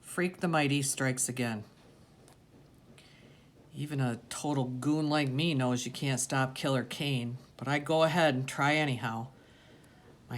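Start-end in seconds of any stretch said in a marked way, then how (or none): none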